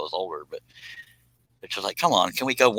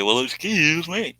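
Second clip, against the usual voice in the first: Steve Martin impression; 8 semitones higher, slower than usual. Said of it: "he did that thing ... he'd say".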